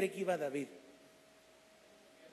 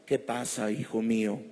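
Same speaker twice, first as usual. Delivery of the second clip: mock God voice; 6 semitones lower, 5 dB louder than usual. Preacher mocks expecting God to talk aloud, booming low. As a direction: lower and louder, booming. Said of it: "¿Así con su voz?".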